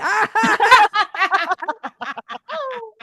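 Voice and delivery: high, forced laugh on cue. Pretend. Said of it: "Everybody laugh with me".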